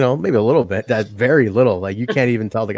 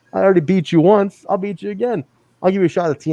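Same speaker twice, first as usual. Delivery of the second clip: mock cocky taunt; 6 semitones higher, faster than usual. Imitating Moose's taunt.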